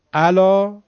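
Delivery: «الا» high and loud, with a plain glottal onset for ayn; ayn as hamza. Shown as a demonstration. Teacher showing ayn confused with hamza, «الا» said with a Persian onset. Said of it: "همزه و با عینش با هم هیچ فرقی ندارن".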